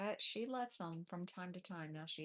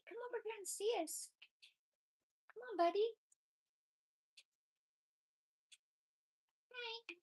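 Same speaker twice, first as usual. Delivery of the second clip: very high, sing-song voice for the babies; 14 semitones higher than usual. Real, not acted. Talking to the kittens in high baby talk.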